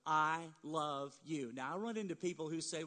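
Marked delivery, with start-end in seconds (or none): 0.1-1.5 slow, gentle I love you; tender I love you. Shown as a demonstration. Pastor showing parents how to say it, tender and slow. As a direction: say it softly to your child, slowly. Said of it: "you need to tell your child".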